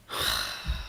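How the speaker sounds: long, heavy exhale; real breathy sigh of disbelief; exasperated sigh. Real, not acted. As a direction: let out a weary sigh. Exasperated at her not learning.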